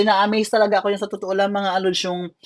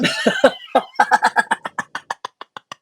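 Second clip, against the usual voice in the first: airy laugh; 7 semitones higher than usual. Real, not acted. Laughs at himself after cheering.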